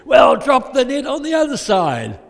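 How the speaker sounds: high, loud holler; sustained long-distance yell; yelled instruction. Acted. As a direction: shout directions out to a distant boat. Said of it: "So he probably yelled out".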